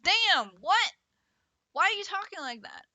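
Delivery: loud, high shocked outburst; appalled listener, loud and high; shocked reply. Retold or imitated. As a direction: blurt it out in disbelief. Mock shocked reaction to rude remark.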